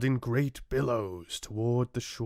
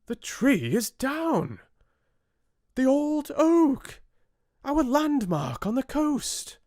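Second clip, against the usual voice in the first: much higher, louder alarmed shout; sailors exclaiming; 4 dB louder than usual. A narrator's reading. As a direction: cry out in shock at the fallen landmark. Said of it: "exclaimed the sailors".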